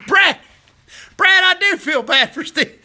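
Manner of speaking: laughing answer to Brad about Steve. Real, not acted. Laughing at viewer's question.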